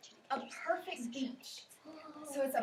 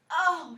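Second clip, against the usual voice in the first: louder drawn-out moan; 2 semitones higher, 8 dB louder than usual. Retold or imitated. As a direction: groan like you just lost a game. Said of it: "he moaned".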